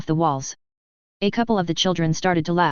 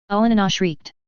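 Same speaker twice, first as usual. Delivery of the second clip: ordinary delivery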